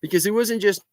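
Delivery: ordinary delivery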